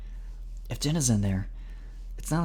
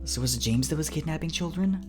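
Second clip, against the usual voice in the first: higher, rising disbelieving question; puzzled question; 9 semitones higher than usual. A narrator's reading. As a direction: ask it puzzled, climbing to the end. Puzzled question about the kidnapper.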